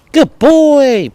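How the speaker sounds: high, loud happy praise; praising the dog. Real, not acted. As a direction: lift the voice high to reward him.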